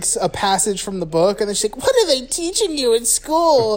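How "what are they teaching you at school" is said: high-pitched imitation of a mother; mock appalled mom. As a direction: question like a scandalized mom, hammed up. Acts appalled mom at breakfast.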